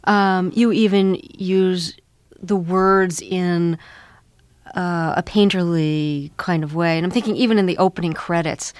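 normal talking voice

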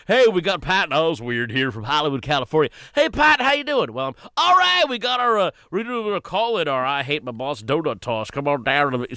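high, over-the-top morning radio host voice; mock a zany drive-time host; hammed-up radio DJ. Comic mocking the morning radio shows he does, hammed-up DJ voice. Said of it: "sometimes I go on Flappy and the Bean".

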